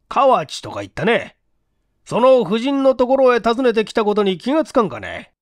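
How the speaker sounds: a questioning detective, lightly voiced; police inspector voice. The inspector grilling the building clerk, in a stern questioning voice.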